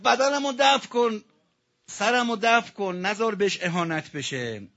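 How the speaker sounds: wailing his last request at full voice; anguished cry. Acted. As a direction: cry the dying man's plea out high and loud. Rawzeh climax, martyr's will.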